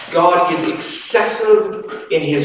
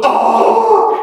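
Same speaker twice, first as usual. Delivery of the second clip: put-on a very high, louder delighted oh of a child; child's mock surprise; 5 dB louder than usual. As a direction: squeal oh like a child seeing the glass filled to the rim. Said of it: "And the child goes".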